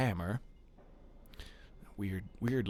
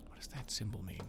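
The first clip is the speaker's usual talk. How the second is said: soft unvoiced whisper; 3 semitones lower, 12 dB quieter, faster than usual. Real, not acted. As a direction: breathe the question out.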